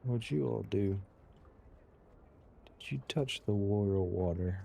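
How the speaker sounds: ordinary delivery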